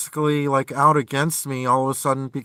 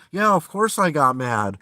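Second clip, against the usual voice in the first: usual speaking voice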